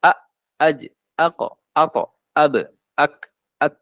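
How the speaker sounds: "A, aj, ako, ato, ab, ak, at" stopped short; held letters. Shown as a demonstration. Teacher recaps held letters, each one cut short. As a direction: shut off each syllable on its consonant. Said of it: "Nah, itu tertahan".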